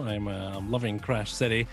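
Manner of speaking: ordinary talk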